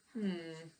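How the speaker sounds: plain reading voice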